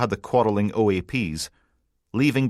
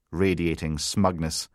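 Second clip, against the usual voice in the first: usual speaking voice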